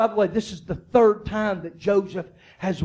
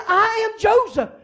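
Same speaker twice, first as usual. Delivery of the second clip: fervent declaration; 12 semitones higher than usual. Acted. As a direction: proclaim it like preaching at full force. Joseph reveals himself to his brothers.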